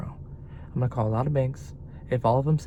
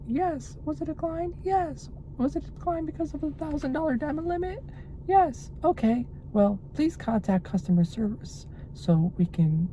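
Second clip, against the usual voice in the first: robot voice; 13 semitones higher than usual. Retold or imitated. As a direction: do the bank's automated phone robot. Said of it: "a robot speaks".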